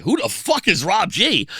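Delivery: a club boss who wants to know who this is; angry demand. Retold or imitated. Angry promoter confronts him.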